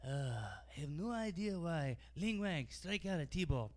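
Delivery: put-on accent, rising to a mock yell; mock Chinese accent. Clerk coaching at T-ball.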